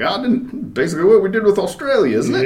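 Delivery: high, loud mock British accent; British comedian impression. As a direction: imitate a British comedian joking. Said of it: "He's like".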